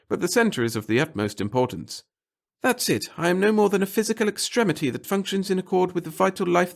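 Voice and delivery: high, loud, self-important speech; pompous little clerk. A narrator's reading. The clerk grandly telling the reporter of his devotion to the center. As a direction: preach your humble service grandly.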